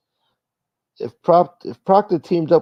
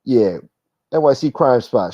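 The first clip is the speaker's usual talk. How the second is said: ordinary delivery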